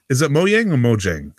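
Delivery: first Mojang as mo-ying, second Mojang as mo-jang; contrasting pronunciations. Shown as a demonstration. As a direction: ask it with Mojang first with a y, then a hard j. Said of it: "Is it Mojang or Mojang?".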